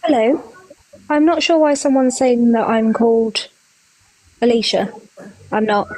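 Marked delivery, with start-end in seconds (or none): none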